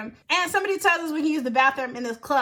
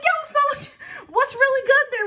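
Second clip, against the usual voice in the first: high, raised-voice mock challenge; confrontational challenge; 11 semitones higher, 4 dB louder than usual. Clubber calling out the bouncer, confrontational. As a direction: re-enact squaring up for a fight. Acted confrontation before a fight.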